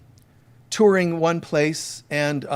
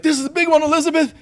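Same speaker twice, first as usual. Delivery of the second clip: excited fan; 12 semitones higher, 4 dB louder, much faster than usual. Retold or imitated. Fan reading signed football message.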